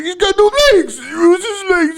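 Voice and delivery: high, loud wailing shout; crying shout. Retold or imitated. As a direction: wail it out, half crying. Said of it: "I was like".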